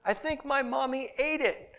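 put-on high, small child's voice with a giggle; little boy. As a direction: play the tearful kid delivering the punchline. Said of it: "he leaned over and said".

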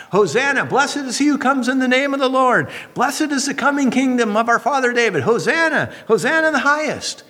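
high, ringing hosanna cry; crowd crying out. Retold or imitated. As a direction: lift it up like a jubilant crowd. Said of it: "They would cry out".

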